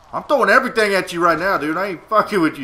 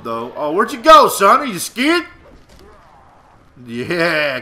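usual speaking voice

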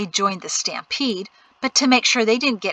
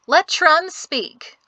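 calling out; 8 semitones higher, 4 dB louder, much slower than usual. Acted. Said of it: "someone called".

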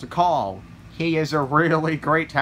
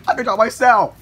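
loud, higher-pitched protest; indignant shout; 5 semitones higher, 8 dB louder than usual. Acted. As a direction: protest loudly over the driving. Arguing over who drives.

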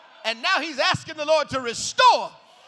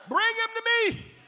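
Jesus commanding; 8 semitones higher than usual. Acted.

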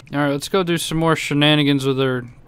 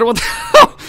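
shocked shriek; 5 dB louder than usual. Real, not acted. Gamer yelping as a UFO appears, laughing shriek. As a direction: cry out in laughing disbelief.

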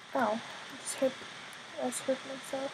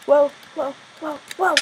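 loud startled whoas; sudden alarmed shouting; 4 semitones higher, 7 dB louder than usual. Real, not acted. Startled mid-jump.

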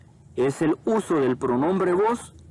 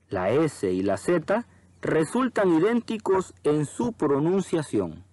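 ordinary talk, nothing marked

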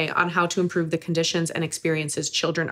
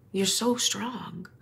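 softer and slow, gently comforting; soft reassurance; 3 semitones higher, 5 dB quieter, much slower than usual. Real, not acted. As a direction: say it slowly, soothing a crying guest. Host comforts a tearful guest.

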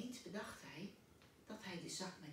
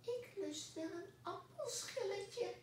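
much higher tiny cockroach voice; tiny little voice; 13 semitones higher than usual. Acted. The cockroach asks Pluk for apple peel, tiny voice. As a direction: squeak it like a very small creature. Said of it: "een heel klein stemmetje".

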